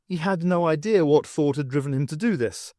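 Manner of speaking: ordinary delivery, nothing marked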